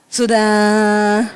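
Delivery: SUDAH held long on a low note; exaggeratedly gentle, drawn-out "sudah". Shown as a demonstration. Struggling learner of refined talk, "sudah" low and stretched. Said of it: "Suruh belakang turunin".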